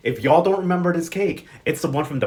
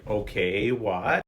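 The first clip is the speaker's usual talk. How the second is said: low, softer retold bafflement; guarded confusion; 7 semitones lower, 4 dB quieter, much slower than usual. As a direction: play her caught off guard, pitched down. Acting Josiane confronted by Teo.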